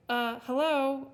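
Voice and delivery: loud, drawn-out sarcastic hello; fed-up, impatient girl; exasperated. A narrator's reading. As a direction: point out the obvious, slowly and loudly. Elsbeth exasperated at obvious answer.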